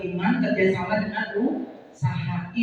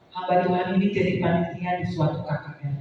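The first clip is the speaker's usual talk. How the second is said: ordinary talk, nothing marked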